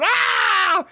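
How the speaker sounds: high mock shout; hammed-up shout; Rove impression. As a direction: yelp like Rove. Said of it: "That's my great Rove impersonation".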